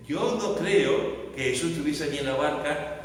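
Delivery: ordinary talk, nothing marked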